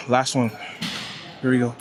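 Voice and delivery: normal talking voice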